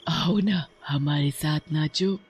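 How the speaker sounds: drawn-out witch's invitation; luring witch. Acted. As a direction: coax them to join you, eerily. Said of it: "एक सरकटी चुड़ैल उनके पास आती है". (चुड़ैल की लुभाती आवाज़)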